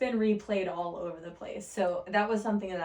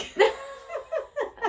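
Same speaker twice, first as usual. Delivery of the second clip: high burst of laughter; delighted high laugh; real laugh; 3 dB louder than usual. Real, not acted. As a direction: laugh out loud at the joke. Laughing at own joke.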